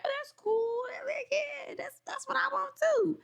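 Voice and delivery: high, whispered mock agreement; squeaky voice of a lying guy; fake nice guy. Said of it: "I'm used to a guy".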